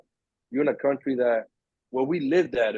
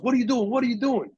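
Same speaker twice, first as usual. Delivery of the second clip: high, jumpy repeated demand; nervous kid snapping questions; suspicious thief; 9 semitones higher than usual. Retold or imitated. Thief demanding answers from the boy on the floor, high and jumpy.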